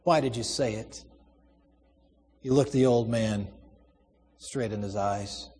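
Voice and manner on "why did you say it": subdued, emotion held in; mastering emotion. A narrator's reading. Son faces father in jail, mastering his emotion. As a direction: keep the feeling under control, softly. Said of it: "struggled to master the emotion in his voice".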